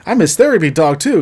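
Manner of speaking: lightly hammed-up cheerful insistence; eager dog. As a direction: pipe up proudly as the dog. Dog's line in acted bickering.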